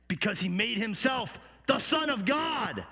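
indignant public accusation; crowd crying out. Acted. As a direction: declare the charge forcefully, punching "Son of God". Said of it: "they began to cry out".

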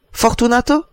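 an astonished father's exclamation; exclamation étonnée. A narrator's reading. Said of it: "s'écria Matteo". (s'écrier avec étonnement)

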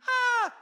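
short, loud mock shriek; quick comic scream of fright; imitated scream. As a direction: yelp like a scared disciple. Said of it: "Disciples scream".

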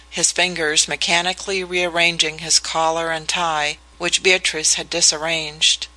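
ordinary delivery, nothing marked

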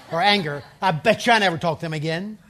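hammed-up grudge for laughs; mock anger.

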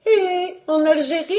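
high-pitched persistent wife; insistent questioning. Acted. Wife fires off another destination.

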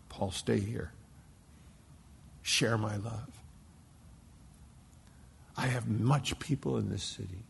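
soft, unhurried, tender; gently, like God inviting him to stay; tender divine voice. Acted. God's gentle call to Paul.

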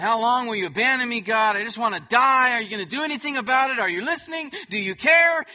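loud, fast, rising complaint to God; anguished lament. Acted. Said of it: "expressing this lament".

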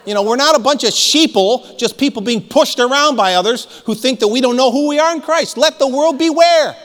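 high, forceful rallying cry; fired-up preaching. Real, not acted. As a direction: build to a loud battle cry.

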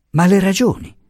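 high-pitched, pleading challenge; heated insistence in an argument; urgent protest. Acted. Acted quarrel between husband and wife.

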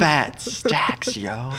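high, breathy whispered slang. Pretend. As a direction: hiss it like a secret brag. Mocking the show's slang.

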